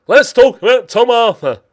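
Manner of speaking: high, loud, silly put-on voice. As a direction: belt it out in a daft high voice. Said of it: "I don't know why I did that voice".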